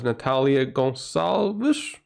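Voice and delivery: slow, Portuguese accent on "Natalia Gonçalves", with "Gonçalves" said as "gon-SAL-vish". Shown as a demonstration. Thanking donor Natalia for her donation, says her name in a Portuguese accent. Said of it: "I'm gonna say with a Portuguese accent".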